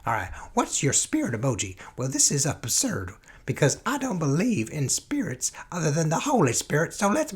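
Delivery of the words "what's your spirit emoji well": normal talking voice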